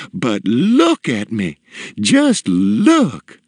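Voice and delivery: high, lilting delighted matron; enraptured and sing-song; enthusiastic self-admiration. A narrator's reading. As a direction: gush over your own reflection, drawing words out. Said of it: "enthusiastically admired her new self".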